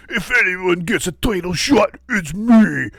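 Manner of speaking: a hint of a big wrestler's voice; imitating Braun. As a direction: growl it out like Braun, lightly. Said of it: "a classic Braun".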